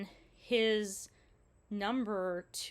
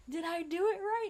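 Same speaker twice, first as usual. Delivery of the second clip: much higher, small and unsure; quoting herself higher while paddling; high self-quote; 10 semitones higher, 4 dB louder than usual. Retold or imitated. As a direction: pipe it up from the water. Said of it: "dog paddling in the water going".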